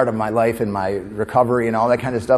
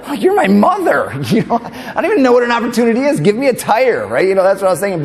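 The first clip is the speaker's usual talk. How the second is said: much higher, louder, laughing through it; laughing disbelief; 9 semitones higher, 4 dB louder than usual. Retold or imitated. Kid laughing in disbelief at mom's advice, high pitched. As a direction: laugh at the absurdity, high and incredulous. Retold kid's outrage, laughing.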